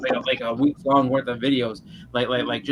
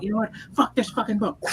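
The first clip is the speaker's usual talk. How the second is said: higher-pitched imitated rage; furious outburst; 9 semitones higher than usual. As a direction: mimic him losing it over the book. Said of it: "And he's like".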